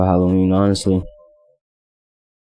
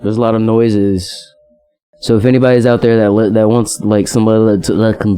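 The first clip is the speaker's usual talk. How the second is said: garbled country mumbler. Acted. Echoing the touchdown memory, garbled country mumbling.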